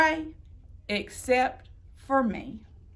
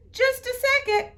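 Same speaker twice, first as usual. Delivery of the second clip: high, quick photographer voice; a lightly voiced busy photographer; 11 semitones higher, faster than usual. Photographer fiddling with knobs, quick high voice. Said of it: "said the photographer".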